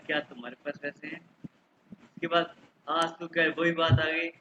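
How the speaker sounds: ordinary talk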